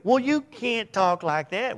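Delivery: high, slow mock protest; mock indignant objector. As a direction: play the offended listener.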